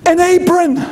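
high, giddy, laughing through it; a contestant giddy over an apron; laughing mock joy. Contestant thrilled at Ramsay's praise, laughing mockery.

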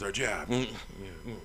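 ordinary delivery

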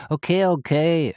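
whining. A narrator's reading. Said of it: "jammerte die blonde Frau".

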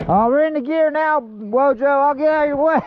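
high-pitched call to a riding buddy. Real, not acted. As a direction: call ahead over some distance. Calls out to riding companion.

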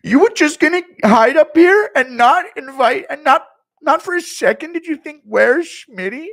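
high, very loud indignant rant; Schmitty rant. Retold or imitated. Streamer as Schmitty scolding partygoers, loud high rant. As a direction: yell it like a wounded ego. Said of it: "Schmitty mad at Anne Frank".

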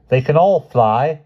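loud, high, slow proclamation; showy declaration to an audience; dramatic. A narrator's reading. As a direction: announce it with a flourish. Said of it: "he stated dramatically".

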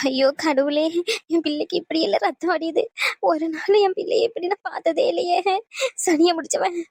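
tearful wailing. Acted. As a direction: lament in broken, sobbing phrases. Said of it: "பாட்டி, அழுகாதீங்க பாட்டி".

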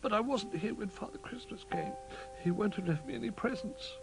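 high, small disappointed voice; sniffly worry. A narrator's reading. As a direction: speak high, like a sad little bear. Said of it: "sniffed Little Bear".

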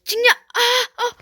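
frustrated shout. Real, not acted.

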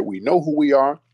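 ordinary talk, nothing marked

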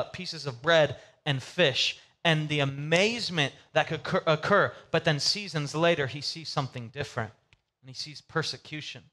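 ordinary talk, nothing marked

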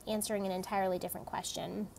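plain reading voice

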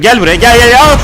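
loud, high, rising "come here"; goading holler, building to a scream; shouted challenge. Real, not acted. Challenging enemy to approach.

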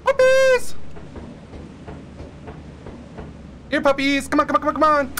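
calling puppies. Pretend. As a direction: coax the dogs like a pet owner. Luring the monster dogs in game.

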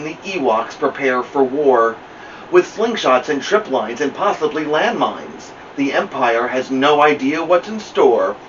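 plain reading voice

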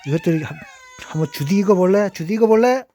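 high, loud mock threat; angry threat. Real, not acted. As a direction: bark a threat at the cats. Threatening the cats loudly. (고양이들한테 크게 윽박지르며)

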